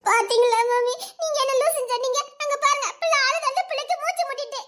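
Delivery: high-pitched, worked-up complaint; insist, pitched high, like a wronged child; indignant protest. Acted. Pointing mother to the crying baby, indignant.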